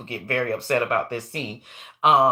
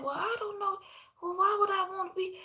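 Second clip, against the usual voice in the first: put-on much higher soft child voice; mock child wondering; little slave girl; 8 dB quieter than usual. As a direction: play the unsure little girl, high and quiet. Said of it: "And she said".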